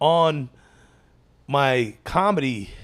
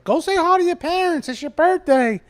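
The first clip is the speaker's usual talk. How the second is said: high, far-off mock mom voice; squeaky mock mother telling him to go; friend's mom; 12 semitones higher than usual. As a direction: imitate a nagging mom, pitched way up. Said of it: "his mom's like".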